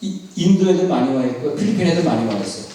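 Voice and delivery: ordinary talk, nothing marked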